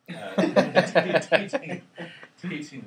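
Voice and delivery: real amused laugh carrying into speech. Real, not acted. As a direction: let the laugh spill into the word. Laughs at his own remark.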